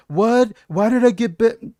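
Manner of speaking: high, loud outraged yelp; hammed-up indignant shout; mock outrage. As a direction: squeal like a wrongly banned user. Acting banned user outraged.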